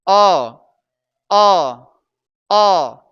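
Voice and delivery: अ as a firm, falling "uh"; isolated vowel model. Shown as a demonstration. Teacher isolating the first sound of the word, emphatic vowel. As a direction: punch out अ alone, loud and separate. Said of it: "अनार में अ आता है".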